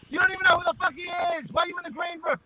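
shrill-pitched imitation shouting him out of the room; mock-furious impression of the comic. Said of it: "He goes".

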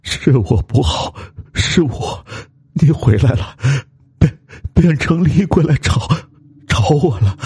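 high, quavering terrified stammer; trembling with fear. Acted. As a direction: stammer as if facing a vengeful ghost. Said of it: "声音也哆哆嗦嗦". (惊恐得哆哆嗦嗦)

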